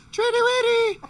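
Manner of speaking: very high, loud falsetto; hammed-up squeaky pet call; high-pitched call. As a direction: sing out in a piercing falsetto to lure a dog. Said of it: "you gotta do it in a high-pitched voice".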